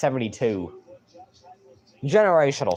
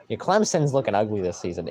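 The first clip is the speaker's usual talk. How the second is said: ordinary talk, nothing marked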